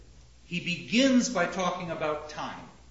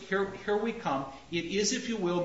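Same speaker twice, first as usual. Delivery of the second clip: ordinary delivery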